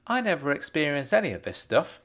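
ordinary delivery

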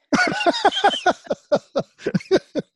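a burst of high laughter; big real laugh. Real, not acted. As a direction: laugh out loud at the joke. Laughing at own quip.

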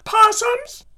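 high campy drag queen voice; high, hammed-up Possums; Dame Edna impression. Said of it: "She used to go".

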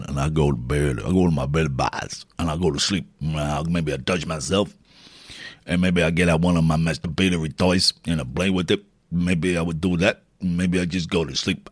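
hammed-up low character voice; put-on character voice. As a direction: play a low-voiced oddball confiding his bedtime plans. Puts on a bedtime character voice.

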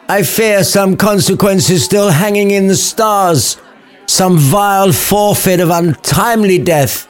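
high, slow overdramatic hero; dramatic Romeo. Acted. Romeo rallying his lads, slow high and grand. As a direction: ham up the noble doom speech.

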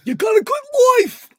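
high, loud put-on yell; hammed-up impression. Quoting the show at the cohost, high mock yell. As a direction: belt it out in a shrieky character voice. Sudden acted impression of a character.

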